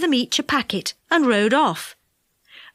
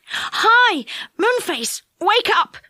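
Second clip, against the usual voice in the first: Joe calling out; 8 semitones higher, slower than usual. A narrator's reading. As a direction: shout to wake his friend. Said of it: "cried Joe".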